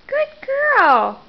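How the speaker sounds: high sing-song praise. Real, not acted. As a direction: lift it up high and sweet. Praising baby while feeding.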